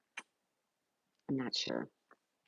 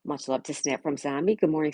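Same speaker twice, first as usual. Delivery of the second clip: ordinary talk, nothing marked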